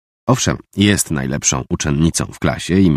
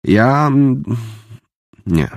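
forced out haltingly; 7 semitones higher, much slower than usual. A narrator's reading. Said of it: "wydusił z siebie".

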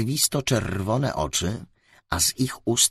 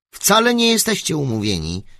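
a touch of accusation, higher and louder; accusing; 8 semitones higher, 6 dB louder than usual. Acted. Set calls out his friends' invented appointment, accusing. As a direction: call out the lie. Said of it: "odparł oskarżycielsko Set".

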